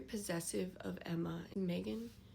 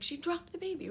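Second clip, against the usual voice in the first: ordinary talk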